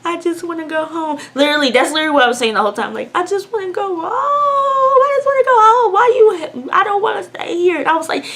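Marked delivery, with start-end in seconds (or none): 0.0-1.2 high, soft tearful plea; mock crying in a small raised voice; imitated crying. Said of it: "sitting there crying like". 3.1-7.8 very high wailing plea; imitated crying. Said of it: "sitting there crying like".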